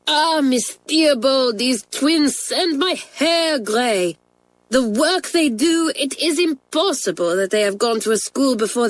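exasperated French teacher. A narrator's reading. Mamselle complains to the head about twins, exasperated. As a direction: lament it dramatically, stretching the words.

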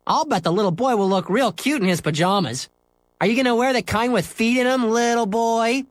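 high, loud mocking singsong; teasing. Scooter ribbing Henry about his pajamas, mocking high voice. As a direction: needle him like a playground tease.

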